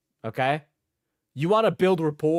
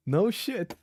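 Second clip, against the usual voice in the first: ordinary delivery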